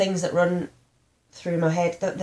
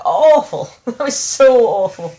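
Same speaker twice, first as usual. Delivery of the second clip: "Awful" loud and drawn out; emphatic disgust; 5 semitones higher, 10 dB louder, slower than usual. Real, not acted. Recalling being given ketamine, "awful" loudly stretched. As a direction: lean hard on "awful", half laughing.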